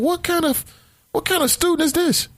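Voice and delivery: high-pitched disbelieving question; incredulous. Retold or imitated. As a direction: voice shoots way up in bafflement. Thinking aloud, appalled at story.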